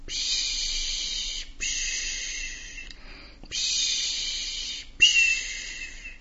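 gehauchtes Meeresrauschen nachahmen; geflüstertes Wellenrauschen. Acted. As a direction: rausche wie Wellen, tonlos. Wellengeräusch nachgeahmt. (nachgeahmtes Wellenrauschen, gehaucht)

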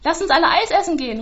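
high, loud call down the stairs. Acted. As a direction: shout cheerfully to the friends below. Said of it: "ruft sie die Treppe hinunter". (laut und hoch die Treppe hinuntergerufen)

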